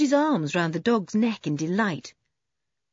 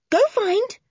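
higher, louder urging; excited urging; 5 dB louder than usual. Acted. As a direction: egg the dog on harder. Urging the dog on, stronger.

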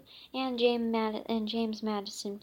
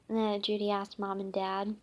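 usual speaking voice

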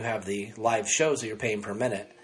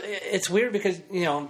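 usual speaking voice

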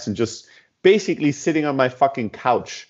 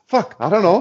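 panicked inner curse; 8 semitones higher than usual. Retold or imitated. Panicking inside, retold.

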